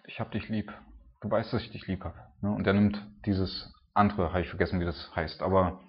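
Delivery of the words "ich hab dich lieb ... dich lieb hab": soft, hesitant confession; barely voiced, humbled reply; hushed answer. Peter answering Jesus, humbled, in a hushed voice.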